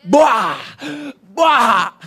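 a big loud bah for the TV hitting the floor; mock crash sound, loud; loud crash noise. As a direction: bang it out like a smash. TV smashed, mimicked crash.

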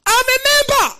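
high, full-force scream; piercing triumphant yell. Retold or imitated. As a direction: scream it from the depths of the spirit. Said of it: "I screamed".

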